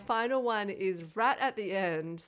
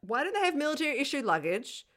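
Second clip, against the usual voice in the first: mock indignant; 3 semitones higher, 6 dB louder than usual. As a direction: complain like it's a real injustice. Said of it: "me to be like".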